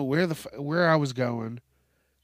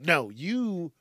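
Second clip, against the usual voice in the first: ordinary delivery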